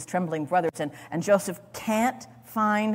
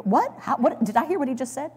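stunned disbelief; 5 semitones higher than usual. Pretend.

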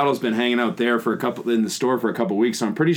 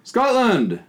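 high, exclaimed announcement; triumphant discovery; 10 semitones higher than usual. Real, not acted. Co-host finds the origin on the label, triumphant. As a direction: call it out like you just won the argument.

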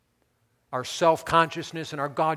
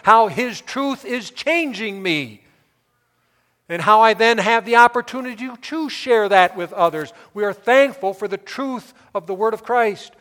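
loud, higher-pitched preaching; impassioned preaching; 7 semitones higher, 11 dB louder than usual. Real, not acted. Preacher thanking God for His truth, impassioned preaching. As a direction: proclaim it forcefully to the congregation.

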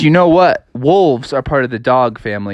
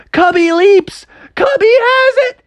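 high, shouted sportscaster call; excited play-by-play; 3 dB louder, much slower than usual. A narrator's reading. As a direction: yell it like the winning score. Play-by-play of winning catch.

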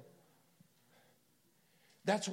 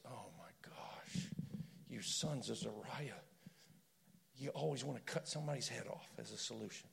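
much softer, nearly whispered, weary sigh of exasperation; 4 semitones lower, 12 dB quieter than usual. Retold or imitated. David to Abishai who wants to cut off Shimei's head, soft weary exasperation. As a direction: mutter it softly, fed up with their violence. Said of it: "And David goes".

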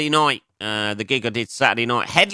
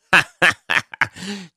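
high laugh; 9 semitones higher, 3 dB louder than usual. Real, not acted. Laughs at own joke.

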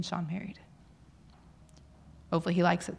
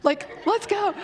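much higher, laughing cheerful call; husband cheering her on, laughing, much higher; mock eager husband; 11 semitones higher, 4 dB louder than usual. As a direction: toss it off laughing, gung-ho. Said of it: "And he's like".

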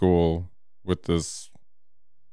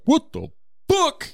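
startled driver; 3 dB louder, slower than usual. Retold or imitated. Driver whose car was egged.

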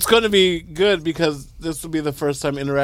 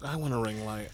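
ordinary talk

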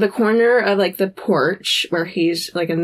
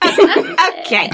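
high-pitched imitation of a flustered okay; small high voice, playfully mimicked; little okay; 10 semitones higher than usual. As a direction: squeak it out, half laughing. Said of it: "I love her just little".

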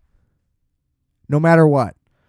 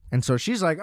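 ordinary talk, nothing marked

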